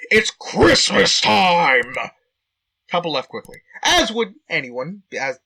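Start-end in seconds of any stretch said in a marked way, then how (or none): 0.1-1.9 slow, loud, drawn-out scream. Acted. Kyle screaming at a young couple, loud and drawn-out. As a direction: bellow it at the couple. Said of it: "he screamed".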